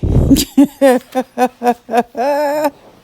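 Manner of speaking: bursting out laughing; a big real laugh; loud laugh. Real, not acted. Mother laughs at the memory.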